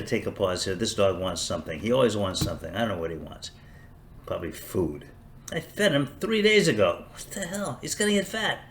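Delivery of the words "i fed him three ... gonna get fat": exasperated. Real, not acted. Exasperated at the dog.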